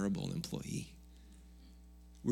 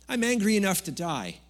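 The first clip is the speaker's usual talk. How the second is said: Jonah's sulky outburst, higher and louder; angry outburst; 10 semitones higher, 4 dB louder than usual. Acted. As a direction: fling it out in a tantrum. Jonah's angry reply to God.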